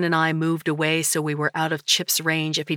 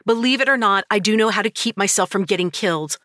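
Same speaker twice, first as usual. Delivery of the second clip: higher and louder indignant retort; heated retort; 5 semitones higher, 4 dB louder than usual. A narrator's reading. Narrator to Dustin, heated retort. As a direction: prove him wrong, fast at the end.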